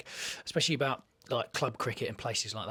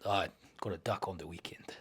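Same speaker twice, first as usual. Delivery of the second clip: low, hushed brooding South African accent; 5 semitones lower, 7 dB quieter than usual. Shown as a demonstration. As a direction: make it sound dark and menacing. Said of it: "sounds dark, sounds brooding".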